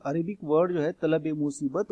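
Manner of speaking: ordinary delivery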